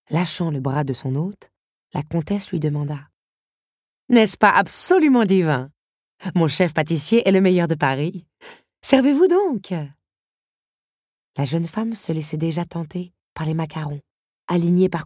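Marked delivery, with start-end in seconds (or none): 4.1-9.7 loud, high-rising gushing hostess; effusive countess. Acted. Countess pressing pastries on her guest, effusive. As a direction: boast and urge like a grand countess.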